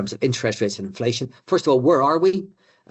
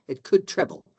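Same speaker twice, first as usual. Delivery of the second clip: "could" lifted and leaned on, "treble" plain; stress on could; 4 semitones higher than usual. Shown as a demonstration. Economics speaker warns audience debt "could" treble, stressed. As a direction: hit "could" a little higher to warn. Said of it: "and I stress the word could".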